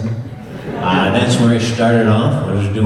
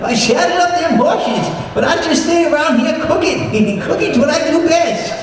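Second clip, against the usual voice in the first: put-on much higher cackling old-man voice; Walter Brennan impression. Answering the Duke, an old sidekick cries out high and cackling. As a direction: play a giddy old cowboy cook yelling. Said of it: "Hey, Walter Brennan".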